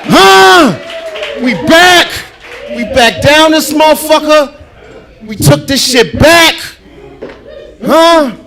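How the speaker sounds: shouting high to the crowd; real hype-man yelling at the crowd. Real, not acted. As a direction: pump up the room, full-throated. Host hypes up the club crowd.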